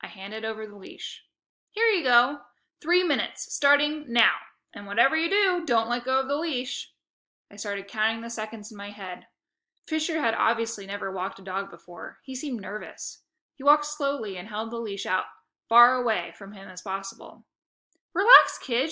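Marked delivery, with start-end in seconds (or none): 1.7-6.9 a touch of loud businesslike bossiness; brisk and in charge; bossy kid boss. A narrator's reading. Otto instructs Fisher on the walk, brisk and bossy. Otto handing over leash. 18.1-18.9 loud, breezy and high; cocky reassurance. A narrator's reading. Otto teases nervous Fisher, loud and cocky.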